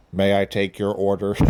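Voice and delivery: measured, monotone robot voice; generic robot. Acted. Talking bot takes a drive-thru order, generic robot voice. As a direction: do a stock order-taking robot. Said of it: "that's a very generic sounding robot".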